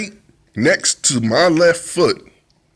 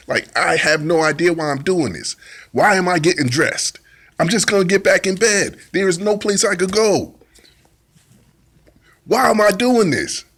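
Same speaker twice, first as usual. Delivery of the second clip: higher and higher, fed up; rising exasperation; 5 semitones higher than usual. Retold or imitated. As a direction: build from puzzled to exasperated. Arguing aloud with the feeling.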